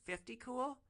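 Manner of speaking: high put-on bargaining voice; streetwise woman voice. Hooker naming her price, high put-on voice. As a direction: toss out the price casually.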